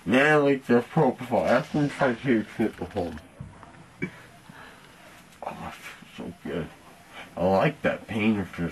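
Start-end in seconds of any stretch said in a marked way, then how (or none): 5.4-6.7 soft relieved whisper. Real, not acted. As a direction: breathe it out softly. Groggy patient relishing relief.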